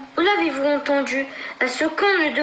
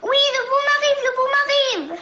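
shouting; 10 semitones higher, faster than usual. Acted. Cat repeats the alarm.

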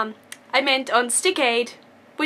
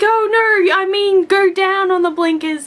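imitating the instructor; 7 semitones higher, 7 dB louder than usual. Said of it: "and she's like".

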